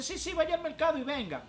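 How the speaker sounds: high, loud woman on the phone; retold phone plea, pitched well up; imitating urgent caller. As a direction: act out a church sister summoning someone urgently. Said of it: "Me dice".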